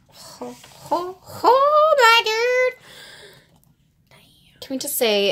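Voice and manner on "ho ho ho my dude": breathy start building to a high squeal; thrilled squeal. Real, not acted. Fan reacting to a favourite idol's card, building squeal.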